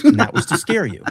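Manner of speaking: high, loud, fast mock imitation. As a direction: ham up his dramatic line. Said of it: "Who talks like this?".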